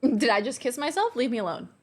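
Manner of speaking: normal talking voice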